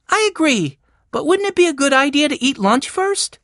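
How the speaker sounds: put-on high-pitched boy character; eager young Benny. A narrator's reading. Benny asks for lunch first, in a high child's voice. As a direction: pipe up high and hopeful.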